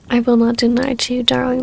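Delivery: ordinary delivery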